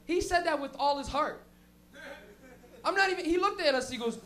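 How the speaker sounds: ordinary delivery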